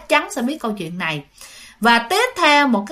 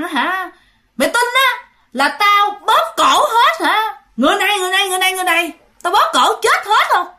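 high, loud menacing spirit voice; snapping back; 9 semitones higher, 4 dB louder than usual. Retold or imitated. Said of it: "bà nạt lại". (giọng hồn ma quát lại đe dọa)

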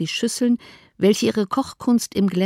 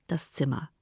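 usual speaking voice

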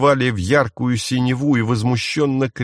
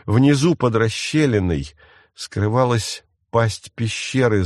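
usual speaking voice

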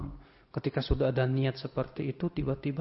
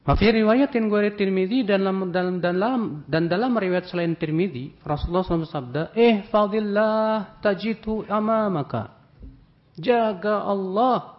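loud, higher, fervent sermon delivery; fiery preaching; 6 semitones higher, 7 dB louder than usual. Acted. Preacher raising his voice to the congregation, fiery. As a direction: preach it out with full force.

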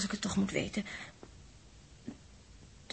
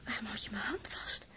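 hushed, softer whisper; 5 semitones lower, 8 dB quieter than usual. Acted. Els asking Dirk why he holds her hand, soft whisper. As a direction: whisper it, dreading the answer.

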